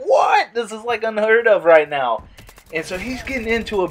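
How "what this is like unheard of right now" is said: high, excited "what?"; imitating stunned teammates; a touch of hyped surprise; mock disbelief. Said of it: "We're like".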